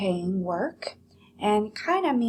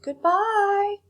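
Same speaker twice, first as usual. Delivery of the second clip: high, louder calling goodbye; 13 semitones higher, 5 dB louder than usual. Acted. Nellie calling goodbye after her daughter, high and louder. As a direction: call after her a second time. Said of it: "louder this time".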